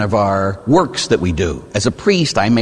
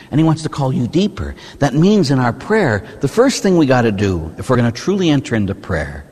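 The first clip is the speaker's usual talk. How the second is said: ordinary delivery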